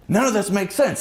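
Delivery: sudden realization. Retold or imitated. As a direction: it finally clicks. Wife suddenly realizing it's scam.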